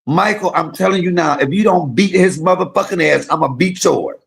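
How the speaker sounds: mama shouting out the window at her son; retold motherly yell. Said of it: "She said".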